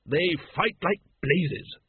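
a bluff dragon-breeder's relish; hearty noblewoman. Acted. Lady Ramkin explains dragons to Vimes, hearty and slow. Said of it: "said Lady Ramkin".

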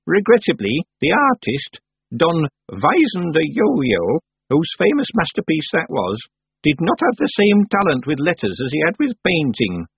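put-on a stern old witch explaining patiently; old witch Miss Treason.

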